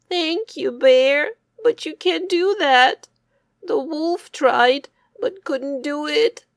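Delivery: high, loud, tearful refusal; despairing hare. Acted. Hare telling bear it's no use, high and tearful. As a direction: plead it hopelessly.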